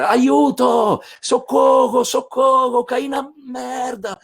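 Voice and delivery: high, loud mock yell for help; cry for help. Andreuccio stuck in the latrine calling for help, high mock yell. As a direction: shout up from the pit like a trapped man. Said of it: "começa a gritar".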